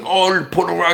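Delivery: lightly mocking a mouth-full voice; mouth-full imitation. Vlogger lightly mimics a YouTuber's wet, mouth-full voice. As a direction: talk as if food is in your mouth. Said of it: "sounds like he has food in his mouth".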